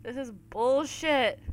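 normal talking voice

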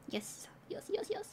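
breathy whispered yeses; whispering. Real, not acted. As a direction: whisper it, then squeak up.

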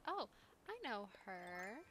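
ordinary talk

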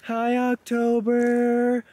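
very high, drawn-out coo; high cooing greeting. Real, not acted. Greeting the newborn animal from afar.

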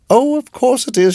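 high, fluttery exclamation; landlady's cry. A narrator's reading. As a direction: cry out as a flustered hostess. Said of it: "she cried".

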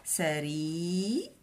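سري stretched very slowly; teacher building the word slowly; sounding out syllables. Shown as a demonstration. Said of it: "حرف السين عنده فتحه".

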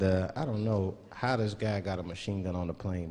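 usual speaking voice

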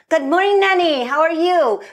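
high, loud bright hello; cheery valet greeting. Retold or imitated. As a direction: greet her warmly and brightly. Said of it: "And they were like".

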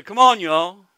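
loud Oklahoman holler; Oklahoma twang. Shown as a demonstration. Preacher rallying his home crowd, loud Oklahoma twang. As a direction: call out big in an Oklahoma accent. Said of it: "So if I've got an accent".

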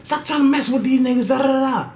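high, fast mock scolding; brother spazzing. Brother yelling at sister, high mock scolding. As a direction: tear into her like a big brother. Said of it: "her brother used to spaz on her".

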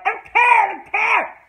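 high loud shouted refusal; fed-up shout. Real, not acted. Fan shouting at the show's writers, loud. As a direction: yell it, done with this plot. Fan ranting about storyline.

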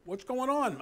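high, surprised call; startled question. Retold or imitated. Wife calling from the next room, surprised. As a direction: ask as if startled by a shout. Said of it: "Crystal will go".

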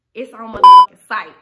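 loud, punched threat; loud angry threat at the girlfriend; furious vow. Retold or imitated. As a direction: swear vengeance with a loud burst. Angry vow in her head.

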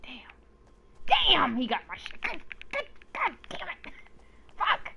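hissed high whisper of damn; whispered frustrated swearing; whispered curse. Real, not acted. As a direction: curse under your breath in dismay. His loot just got taken.

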